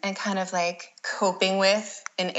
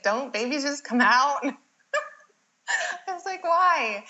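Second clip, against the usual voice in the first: incredulous; 8 semitones higher than usual. Retold or imitated. Incredulous at midwives' rule.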